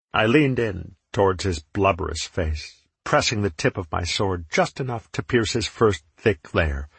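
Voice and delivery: ordinary delivery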